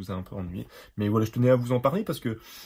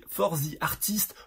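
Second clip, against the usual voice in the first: slow, loud English with French accent; mock-proud English accent; 6 semitones higher, 6 dB louder, slower than usual. Real, not acted. Reading the brush slogan to viewers, hammed-up English accent. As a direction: ham up a clumsy English accent, higher and slower. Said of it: "Avec mon super accent".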